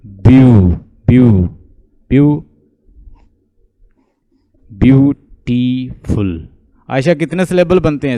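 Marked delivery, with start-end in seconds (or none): 0.1-2.4 "ब्यू" repeated loudly, each one separate; isolated syllable. Shown as a demonstration. Teacher isolates the first syllable. 4.7-6.5 "ब्यूटीफुल" slowly in three parts, ब्यू, टी, फुल; syllable by syllable. Shown as a demonstration. Teacher splits word into syllables.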